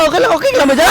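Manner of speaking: ordinary delivery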